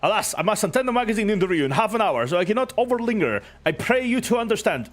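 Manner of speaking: high, booming, grandly cheerful hero; jovial knight voice. Acted. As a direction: proclaim it like a beaming showman.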